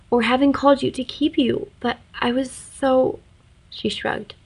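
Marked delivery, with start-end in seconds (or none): 0.1-3.2 stammering. Acted. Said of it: "she stammered".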